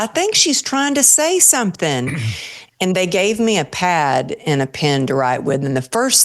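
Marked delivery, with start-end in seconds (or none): 0.0-2.2 high, light imitation of the nurse. Nurse telling the others the patient wants to speak, high light voice. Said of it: "the nurse comes over and says".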